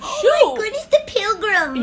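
high, slow gasp of wonder; amazed. Real, not acted. Host amazed by a town founded in 1630, high slow wonder. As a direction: marvel at the history.

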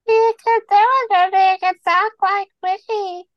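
high squeaky voice. Pretend. Helium-like high voice.

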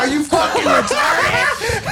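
very high, drawn-out laughing shriek; retold scream. Driver screaming at the badly parked woman, hammed-up shriek. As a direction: scream it slowly, high, cracking up. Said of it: "start screaming it".

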